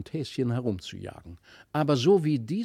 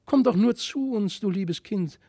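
put-on high, longing call to the child; children reaching out, calling to the strange child, higher and louder; eager calling out; 10 semitones higher, 4 dB louder than usual. A narrator's reading. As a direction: call out high, full of yearning. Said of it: "so riefen beide".

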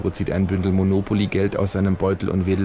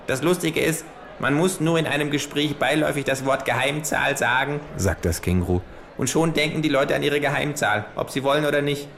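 kangaroo voice; 8 semitones higher than usual. Acted. Kangaroo fanning itself with play money, smug higher voice. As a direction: deliver it like a smug know-it-all.

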